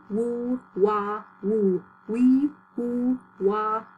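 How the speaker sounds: drawn-out, evenly spaced syllables; articulation drill. Shown as a demonstration. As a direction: chant each syllable deliberately for the class to copy. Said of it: "You say it with me".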